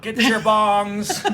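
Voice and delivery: slow, high, loud hawker's cry; mock yell. A joke vendor shouting to a crowd about bongs, slow and loud. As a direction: drag it out like a street vendor. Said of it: "Somebody's yelling out".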